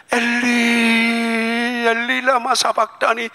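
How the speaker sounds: slow, high, long-held lament; Jesus crying from the cross; drawn-out cry of Jesus. Acted. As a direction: stretch the words into a lament.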